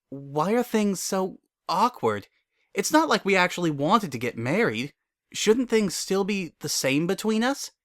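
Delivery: pleading gently with a friend; Twilight's voice. Acted.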